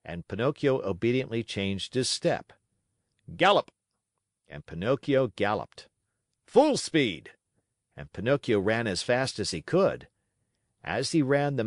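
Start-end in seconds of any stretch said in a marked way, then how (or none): 3.4-3.7 loud, high shouted order; ringmaster's command. A narrator's reading. Manager driving Pinocchio around the ring, loud shout. 6.4-7.3 loud, high call; ringmaster's command. A narrator's reading. Manager driving Pinocchio faster, loud high call.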